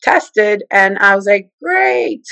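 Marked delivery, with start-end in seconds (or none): 1.6-2.2 high sarcastic "great". Retold or imitated. Sarcastic after bad news.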